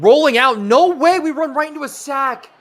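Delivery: loud, high-pitched protest; exasperated disbelief. Real, not acted. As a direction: voice jumps up in disbelief at the play. Reacting to being sacked live.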